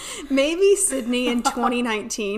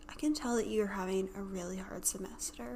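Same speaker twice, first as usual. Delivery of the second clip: softer, gentle imitation of a kind advisor; 2 semitones lower, 10 dB quieter than usual. Imitating the kind advisor comforting a stressed student, softly. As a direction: coo it like a sweet old lady.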